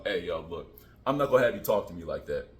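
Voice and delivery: low, firm work voice; deep voice. Shown as a demonstration. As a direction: drop into the deep warning voice. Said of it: "I say the deep voice".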